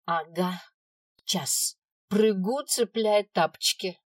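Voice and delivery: put-on loud childish retort; shouting back. A narrator's reading. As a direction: yell it back carelessly. Girl shouts back defiantly.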